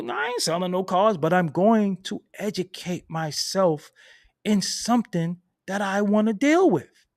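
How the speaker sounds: rising Brooklyn swagger; Brooklyn pride. Retold or imitated. Retold proud reply to dealer.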